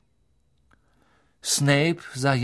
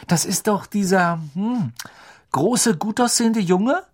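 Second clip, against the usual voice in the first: put-on girlish swooning; 8 semitones higher, 3 dB louder than usual. A narrator's reading. Angelina swooning over a boy.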